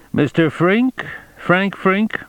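high-pitched questioning man, rising on the name; questioning stranger. A narrator's reading. Stranger approaching Frink to arrest him.

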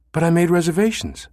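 hurt. Acted.

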